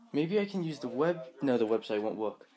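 ordinary delivery

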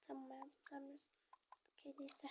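soft unvoiced whisper. Wife quietly giving in to husband, whispered. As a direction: breathe it, barely audible. Quiet aside in doll play.

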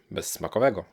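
ordinary delivery

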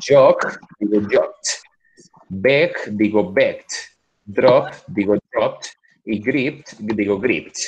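slow, deliberate model words; slow model reading. Shown as a demonstration. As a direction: spell out each past form slowly. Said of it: "Vamos ustedes".

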